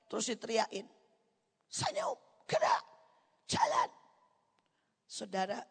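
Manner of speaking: normal talking voice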